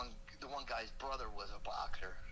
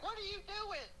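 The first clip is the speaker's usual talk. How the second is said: shocked wife. Retold or imitated. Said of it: "my wife's like".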